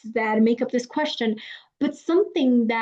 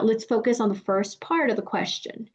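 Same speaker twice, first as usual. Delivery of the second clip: ordinary talk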